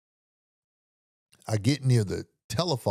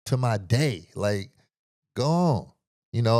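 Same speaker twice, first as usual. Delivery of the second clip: usual speaking voice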